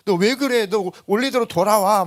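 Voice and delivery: loud, high, urgent friends' plea; friends shaking him. Retold or imitated. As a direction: shake him back to who he was. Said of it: "막 흔들어대죠". (원래대로 돌아오라고 크게 다그치는 친구들)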